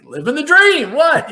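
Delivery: high, loud, flustered reply; startled outburst. Retold or imitated. Rookie to yelling coach, startled and loud. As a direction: blurt it out way up high.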